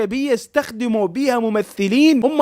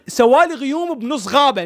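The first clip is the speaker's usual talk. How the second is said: ordinary talk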